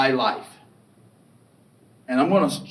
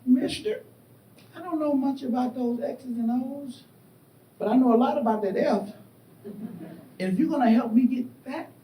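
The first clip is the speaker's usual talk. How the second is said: lightly voiced Southern mother, firm and unbudging; old Southern voice; 7 semitones higher than usual. Mother refusing the coach, in her old Southern voice. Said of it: "And that old Southern voice".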